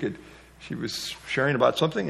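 normal talking voice

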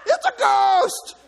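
loud, high, drawn-out cry of terror; terrified shout. Acted. Preacher as disciples who see a ghost, terrified shout. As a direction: shriek the words out in fear. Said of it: "They were terrified".

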